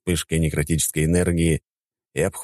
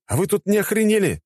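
high, indignant yell; pitched way up in disbelief; exasperated outburst; 3 dB louder than usual. A narrator's reading. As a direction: burst out as if patience just snapped. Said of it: "не выдержал я".

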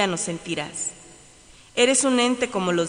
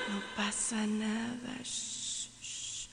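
whispering; 13 dB quieter, much slower than usual. Acted. Calming herself.

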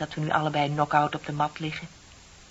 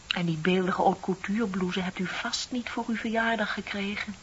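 usual speaking voice

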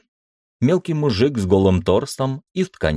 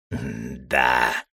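grudging, squeezed low; forced out; 6 semitones lower, 4 dB quieter than usual. Acted. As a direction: force the word out. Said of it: "через силу выдавил побагровевший мужик".

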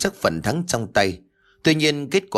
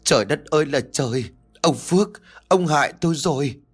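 higher, accusing lament; wailing; 4 semitones higher than usual. A narrator's reading. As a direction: mildly wail and blame, pitched up. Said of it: "miệng bắt đầu bù lu bù loa". (bù lu bù loa, than khóc)

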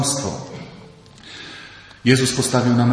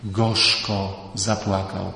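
mournful; 5 semitones lower, 4 dB quieter, much slower than usual. Acted. Preacher slows on weeping.

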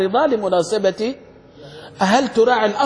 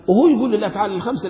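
usual speaking voice

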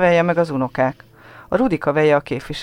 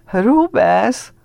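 mocking laugh; 7 semitones higher, 5 dB louder than usual. A narrator's reading. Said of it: "nevet ki a gyerek".

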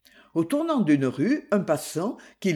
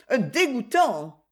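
higher-pitched scornful insult; indignant retort; 4 semitones higher than usual. A narrator's reading. Ruby finishes telling Tonio off, scornful retort. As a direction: throw the insult at him. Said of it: "lança-t-elle".

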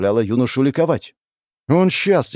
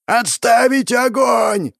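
high, loud, drawn-out slow yell; full-throated shout; 13 semitones higher, 4 dB louder, much slower than usual. Acted. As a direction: roar it with all your lungs. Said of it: "Во всё горло закричал полководец".

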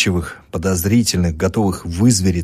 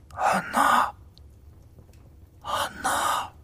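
barely voiced gasping whisper; dying patient's faint whisper; faint moan; 8 dB quieter, much slower than usual. A narrator's reading. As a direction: breathe the words out weakly. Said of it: "сорвалось с полуоткрытых уст".

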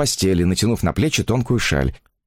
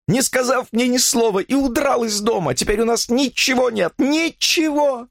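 high-pitched, tearful accusation; weepy woman reproaching her husband; sobbing lament; 13 semitones higher than usual. A narrator's reading. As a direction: wail the complaint, close to despair. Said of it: "всхлипывала она".

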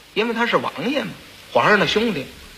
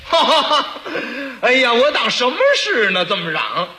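hearty laugh, raised pitch, amused prince; 6 semitones higher than usual. Acted. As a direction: chuckle through it like a delighted bully. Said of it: "他乐了".

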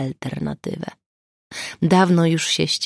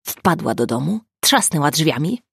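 usual speaking voice

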